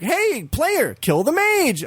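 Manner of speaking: high-pitched mock order; game hailing player. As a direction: call out like an eager game. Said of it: "the game is like".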